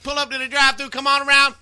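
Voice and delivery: high, loud stuttering cartoon pig; Porky Pig taking an order; Porky Pig at the drive-thru. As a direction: stammer like a flustered cartoon pig. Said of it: "No, it'd be more like this".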